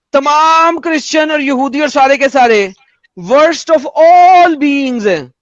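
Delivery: very drawn-out, emphatic pronouncement; declaimed quote. Acted. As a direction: stretch each word for full weight. Quoting scripture at opponent, emphatically.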